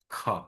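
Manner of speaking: খা said as kha with a puff of breath; খা, not plain কা; aspirated kha. Shown as a demonstration. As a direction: breathe out hard on খা. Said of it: "এটার উচ্চারণ ক না হয়ে হবে".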